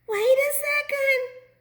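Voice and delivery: very high, loud, slow cry. A narrator's reading. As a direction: call out in high alarm to stop them. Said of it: "cried Half Baked Bean".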